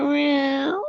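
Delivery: high mock cat meow; a light, high meow impression; imitated meow. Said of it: "You said".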